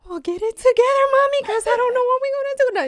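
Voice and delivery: high, loud squeaky panic; husband's mock panic. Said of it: "he's like".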